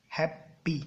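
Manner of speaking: Happy broken in two, "hap", pause, "pee"; split into syllables. Shown as a demonstration. Teacher showing students two syllables, happy split apart. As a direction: say happy as two separate beats. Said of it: "देखो दो syllable है".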